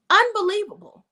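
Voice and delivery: high, loud exasperated "Unbelievable."; disbelief. Real, not acted. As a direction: throw up your hands in disbelief. Disbelief at character's choices.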